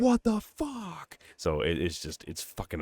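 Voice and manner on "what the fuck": very high, slow exclamation; mock waking terror. Host acts out the father waking from the nightmare, drawn-out freak-out. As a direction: drag out each word in high disbelief. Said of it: "he wakes up like that".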